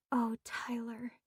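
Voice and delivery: soft, pitying whisper; a hushed whisper to an injured friend. Acted. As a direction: breathe it out in sympathy. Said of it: "Mila whispered".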